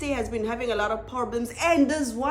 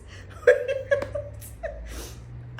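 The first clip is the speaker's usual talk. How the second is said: high breathy laugh; 14 semitones higher than usual. Real, not acted.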